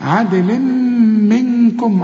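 عدل منكم with a long hummed م and hidden ن; double ghunna. Shown as a demonstration. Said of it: "عندك اتنين غنة".

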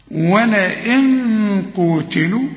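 slow ولئن قوتلوا with the nun hidden before the qaf; nasal hiding. Shown as a demonstration. Teacher models the hidden nun, slow recitation. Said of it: "تاخد بالك من كل نون".

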